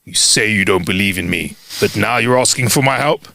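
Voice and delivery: a hint of a low voice of God; booming heavenly voice. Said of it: "A voice from the heavens boomed".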